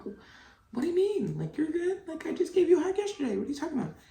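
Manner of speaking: a high sing-song dismissive mom; mock defensive self. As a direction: play your own clueless defensiveness. Mocking her own defensive reply.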